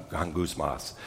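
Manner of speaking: gangusmas said very low and hushed, almost under the breath; weird-sounding Greek word. Shown as a demonstration. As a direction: murmur gangusmas low like a grumble. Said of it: "that sense of mumbling".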